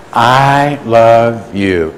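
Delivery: very slow, each word held out; leading in unison. Real, not acted. Preacher leads the room saying it together, slow and drawn out. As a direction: stretch the words so the room follows.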